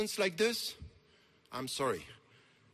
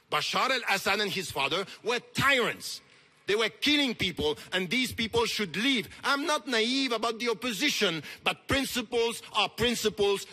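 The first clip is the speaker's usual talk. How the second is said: loud, raised-pitch condemnation from the podium; loud, fervent orator; impassioned preaching; 6 semitones higher, 6 dB louder than usual. Real, not acted. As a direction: preach it with forceful conviction, hammering each point.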